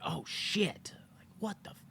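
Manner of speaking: whispered mock shock; awed whisper. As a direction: whisper it as realization hits. Acts out viewer's dawning shock.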